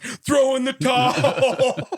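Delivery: hammed-up Stallone in the corner; big lazy trainer's bellow; Stallone impression. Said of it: "Sylvester Stallone's in the corner like".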